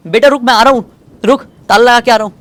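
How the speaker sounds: high, loud call to his daughter. Acted. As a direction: shout across the yard, hurrying. Father calls to daughter. (बेटी को ऊँची आवाज़ में पुकारना)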